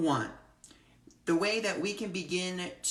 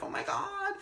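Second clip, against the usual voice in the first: high squeal of disbelief; giddy high "oh my God"; excited squeal; 4 dB quieter than usual. Retold or imitated. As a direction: squeak it out, thrilled. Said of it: "I was like".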